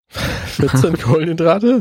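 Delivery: amused, slow incredulous echo; laughing disbelief. Real, not acted. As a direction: laugh while repeating it in disbelief. Incredulous echo of carb count.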